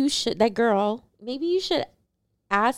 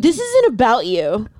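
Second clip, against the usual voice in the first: slow, louder mock scolding; retold put-down; 5 dB louder than usual. Wife shutting out her husband, slow louder put-down. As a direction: imitate a wife putting him in his place.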